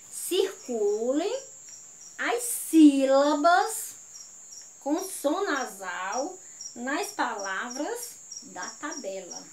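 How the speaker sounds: slow, deliberate dictation pace; measured teacher's dictation with pauses; slow re-reading. Shown as a demonstration. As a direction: spell out the instruction unhurriedly, phrase by phrase. Slow, corrected re-reading of instruction.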